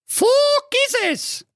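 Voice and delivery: very high squeaky princess, drawn out; slow, shrieky girl's refusal; cried-out refusal. Acted. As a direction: refuse point-blank in a piping squeal. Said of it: "rief da die Carola".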